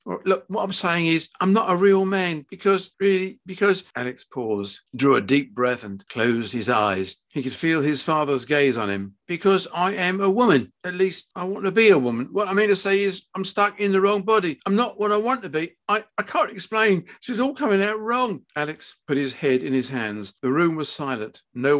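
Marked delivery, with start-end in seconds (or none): 0.1-3.9 higher, pleading explanation; earnest son, words stumbling; faltering confessing son. Acted. 9.3-18.4 high, pleading confession; earnest son pouring it out, high-pitched; distressed confessing son. Acted.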